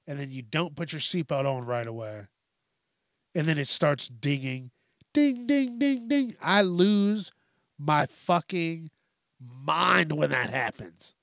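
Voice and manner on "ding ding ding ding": high sing-song ding ding; imitating a car chime. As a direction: chime it out like a seatbelt alarm.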